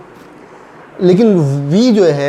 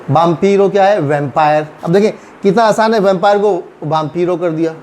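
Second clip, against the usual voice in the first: ordinary delivery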